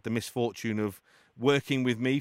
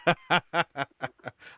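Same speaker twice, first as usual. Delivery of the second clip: real amused laughter; hearty laugh; 5 semitones higher, 3 dB louder than usual. Real, not acted. Host amused by guest's answer, hearty laugh. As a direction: burst out laughing.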